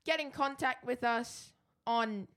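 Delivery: Eggsy. Acted. Co-host reads out contact details as Eggsy, badly faked South London accent. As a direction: struggle through in a put-on South London voice. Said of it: "This has gone really terrible".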